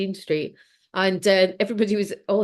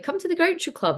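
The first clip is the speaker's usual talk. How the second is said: high, coaxing friend's voice; friend's invitation; 10 semitones higher than usual. Retold or imitated. Friend coaxing her to the Groucho Club, high and eager. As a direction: pitch up to play the pushy friend.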